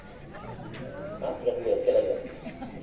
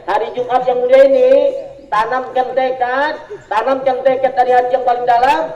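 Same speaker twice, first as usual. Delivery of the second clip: preaching; 4 semitones higher, 4 dB louder than usual. Acted. Preacher urging resolve.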